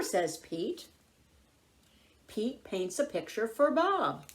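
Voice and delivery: usual speaking voice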